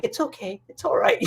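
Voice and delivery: soothing. Retold or imitated. Monk soothing nervous speaker.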